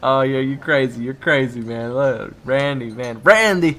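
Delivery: loud fake banter. Waiter [name] joshing with Randy, loud and fake. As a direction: play it up for the coworker.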